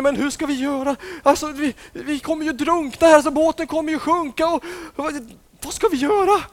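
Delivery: high, loud frightened voices; panicked disciples. Acted. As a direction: cry out as if the boat is sinking.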